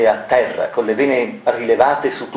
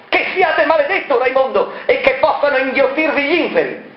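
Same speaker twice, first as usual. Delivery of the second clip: much higher, raging curse; cursing. Acted. Marella curses Variconi in a rage. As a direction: hurl the curse at him. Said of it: "inveendo contro Variconi".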